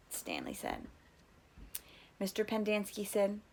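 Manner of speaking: plain reading voice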